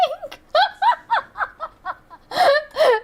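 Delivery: high-pitched burst of laughter; big laugh. Real, not acted. Cracking up after approving the guy's accent, helpless laugh.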